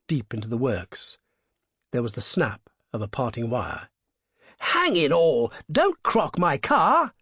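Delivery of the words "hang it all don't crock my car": higher, louder, slow anguished protest; dismayed cry. A narrator's reading. Motorist crying out as the robber cuts the wire, dismayed. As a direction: cry out for the poor car. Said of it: "cried the traveller".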